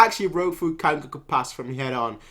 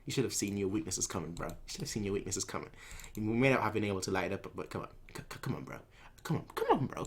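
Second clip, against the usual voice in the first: smug mumbling; 6 semitones lower, 13 dB quieter, faster than usual. Retold or imitated. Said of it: "Kanki's like".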